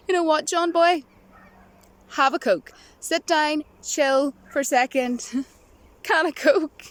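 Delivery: a hint of a chummy pitchman telling him to chill; mock poster voice. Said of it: "that's the way I always say".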